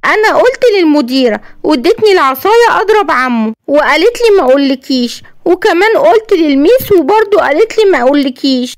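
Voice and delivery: put-on a frightened boy confiding in his mum; scared little boy. Yassin tells his mother he fears for her, childlike and timid.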